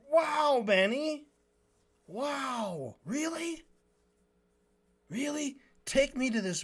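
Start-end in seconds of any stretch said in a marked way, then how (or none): 0.1-5.6 sarcastic wow. Real, not acted. Sarcastic mock astonishment at Benny.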